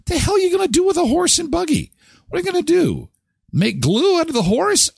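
a clerk baffled by a stolen horse, squeaking it out; incredulous high clerk. Pretend. Clerk reacts in disbelief.

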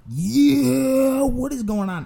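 high, loud drawn-out yelp into a question; shocked disbelief. Retold or imitated. Beer delivery man witnesses a shooting threat, high shocked disbelief. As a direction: exclaim in hammed-up bewilderment.

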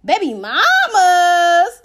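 drawn-out, high, loud exclamation. Acted. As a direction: stretch it slowly in mock outrage.

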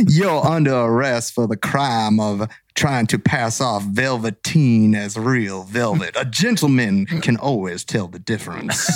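slow, lofty mock-gentleman arrest; gentleman cop. Friend continuing the velvet-suited cop bit, slow and lofty. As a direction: savour each word like a dandy.

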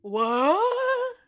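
shocked what. Retold or imitated. John shocked when the stranger asks for money, surprised. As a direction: yelp it in disbelief. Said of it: "He's like".

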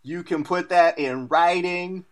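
drawn-out swaggering fighter voice; hammed-up slow bravado; Chael Sonnen impression. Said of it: "my impression of Chael Sonnen".

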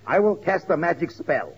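usual speaking voice

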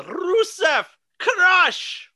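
high, drawn-out mock war cry; shouted catchphrase. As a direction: imitate a ringside catchphrase scream, slow and very high. Mimics Lana's signature shouted catchphrase.

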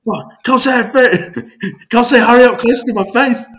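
high, loud, slowed laughing yell; mock yell while laughing. Reading teammate's group chat, mock yell through laughter. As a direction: read the yelled line, cracking up. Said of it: "Yelled out".

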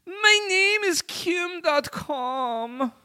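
put-on high, loud German accent. Kim Dot Com answering the hackers in a German accent. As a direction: push a squeaky German accent, slow at the end. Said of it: "a German accent".

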